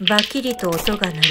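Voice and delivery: plain reading voice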